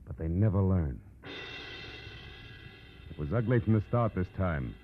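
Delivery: hard-boiled narrator delivering the moral; dramatic radio narration. Acted. Opening crime moral and teaser.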